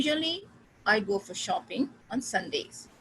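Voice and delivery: slow, phrase by phrase with pauses; split into thought groups. Shown as a demonstration. Teacher to class, slow thought-group demo. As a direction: break it into three thought groups. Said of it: "तीन thought group में मैंने divide किया".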